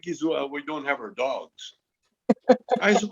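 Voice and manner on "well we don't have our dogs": apologetic. Retold or imitated. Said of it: "the guy got all apologetic".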